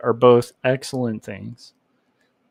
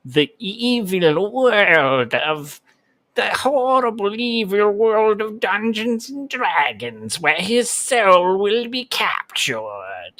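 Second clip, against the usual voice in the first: slow, higher villain voice, hammed up; sinister storyteller, a bit higher; evil voice; 7 semitones higher, slower than usual. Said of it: "Does that sound good for, like, an evil, uh, voice?".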